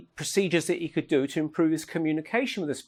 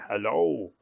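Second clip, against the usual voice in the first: put-on low, drawn-out European-accented hello; 6 semitones lower than usual. Spirit caller greeting the researcher by phone, European accent. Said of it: "a European accent".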